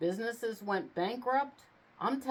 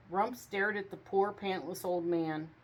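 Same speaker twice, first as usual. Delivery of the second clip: usual speaking voice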